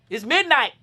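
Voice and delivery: normal talking voice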